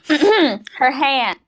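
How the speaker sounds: ordinary talk, nothing marked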